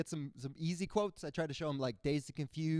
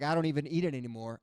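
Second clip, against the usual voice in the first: ordinary talk, nothing marked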